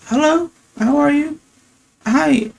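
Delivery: put-on very high, small old-lady voice. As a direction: imitate an old lady answering the door. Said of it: "it'd be an old lady that opened".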